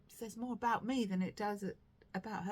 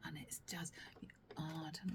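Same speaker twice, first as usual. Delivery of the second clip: 1 whisper; 4 semitones lower, 9 dB quieter, faster than usual. Real, not acted. Whispering over the soft yarn.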